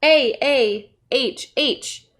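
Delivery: easy, breathed-out ah; relaxed sigh. Shown as a demonstration. Teacher showing what the letters mean, relaxed sigh. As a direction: say ah as a contented sigh. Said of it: "like relaxing".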